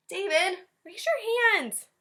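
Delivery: high, slow, loud teacher voice; exasperated teacher. Acted. Teacher telling David to raise his hand, high and slow. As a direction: draw it out, high and impatient.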